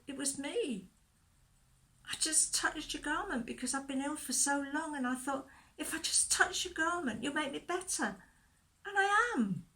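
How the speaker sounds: a touch of fear, rising at the end; timid fear. Acted. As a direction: own up nervously, voice lifted. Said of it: "She was shaking with fear".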